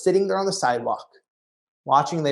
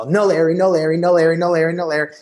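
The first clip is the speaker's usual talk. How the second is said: rapid, louder "no Larry" chant; frantic muttering while running aisles; breathless scanning for Larry; frantic search; 2 semitones higher, 6 dB louder than usual. Retold or imitated. Frantic search through store.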